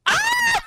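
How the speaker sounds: very high, loud shriek; piercing yelp breaking into laughter; startled scream. Real, not acted. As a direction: shriek suddenly at the top of the voice. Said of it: "[name] just screamed".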